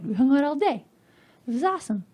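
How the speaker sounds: ordinary delivery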